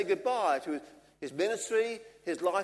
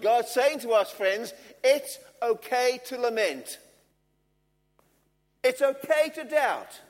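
raised, insistent preacher's voice; higher, strong stress on each "okay"; emphatic preaching; 6 semitones higher, 5 dB louder than usual. Real, not acted. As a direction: press each reassurance home with force. Preaches with rising force.

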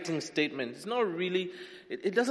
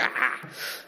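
airy laugh. Real, not acted. Laughs at own mock preaching.

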